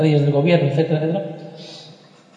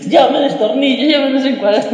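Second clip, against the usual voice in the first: laughing; 6 semitones higher than usual. Real, not acted. Teller laughing at his own joke.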